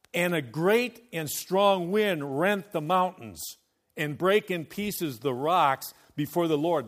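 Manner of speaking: loud, ringing sermon reading; preaching scripture. Acted. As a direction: proclaim the verses to the hall.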